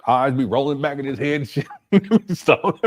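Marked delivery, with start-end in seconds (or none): 1.7-2.3 short loud laugh; burst of laughter. Real, not acted. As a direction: crack up. Cracking up at his joke.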